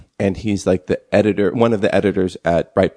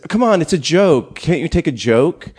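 higher-pitched imitated exasperation; mock protest; 8 semitones higher than usual. Imitating him after an inflammatory remark, mock protesting. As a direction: imitate a provocateur brushing off offence. Acting out his defence to critics.

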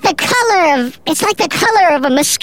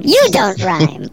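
usual speaking voice

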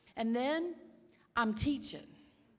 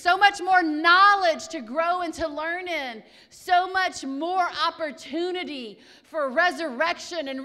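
rising preacher's build, louder; building sermon; 8 semitones higher, 10 dB louder than usual. Real, not acted. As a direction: swell each "so much more".

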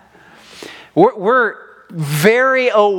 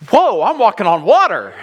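alarmed whoa; 6 semitones higher, 4 dB louder than usual. Retold or imitated. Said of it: "And then he goes".

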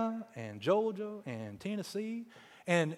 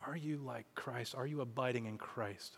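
quiet exhortation; 3 semitones lower, 11 dB quieter than usual. Real, not acted. Quiet exhortation after story.

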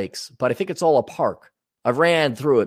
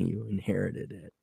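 ordinary talk

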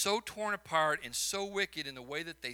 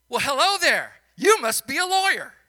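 cheerful greeter; 8 semitones higher, 10 dB louder than usual. Retold or imitated. Quoting the store greeter.

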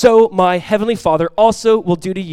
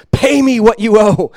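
slow, louder threatening demand; menacing demand; 4 semitones higher, 6 dB louder, slower than usual. Acted. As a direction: squeeze the words out like a creditor choking a debtor. Servant seizes debtor by throat.

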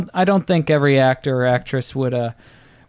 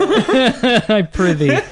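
slow, laughing Shakespearean ham; hammy stage actor; 7 semitones higher, 3 dB louder, much slower than usual. Pretend. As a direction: overplay it like an old stage actor. Archaic stage reply, slow, laughing.

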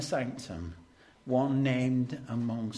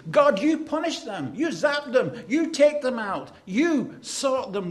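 high, loud, punchy demands to God; acted outraged prayer, emphatic on each YOU; angry plea; 10 semitones higher, 9 dB louder than usual. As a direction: fire off each demand in hurt anger. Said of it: "in our hurt and our anger".

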